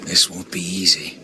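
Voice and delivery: hushed stealthy whisper; breathed warning to an ally. Acted. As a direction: keep it down so the enemy can't hear. Sneaking up on enemy soldiers.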